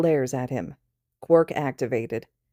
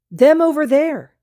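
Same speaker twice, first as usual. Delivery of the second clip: very high, loud blurt; excited drunk shout; 11 semitones higher, 10 dB louder than usual. Acted. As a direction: blurt it at the wall wide-eyed. Drunk student pointing at wall.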